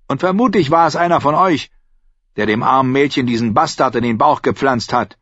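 high, agitated scolding; indignant innkeeper. A narrator's reading. Innkeeper accusing his companions over a pregnant girl, indignant. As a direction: rant at them in exasperation.

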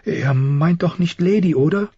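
put-on high, anxious young girl's question; fearful girl. A narrator's reading. Sansa turning to her father about Lady, afraid. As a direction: ask in a high, frightened voice. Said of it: "Ihre Augen waren angsterfüllt".

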